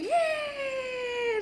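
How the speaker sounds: very high squealed yay; playful scream. Pretend. Streamer screams for the chat, very high squeal. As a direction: shriek it like a cheer. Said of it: "That's my scream".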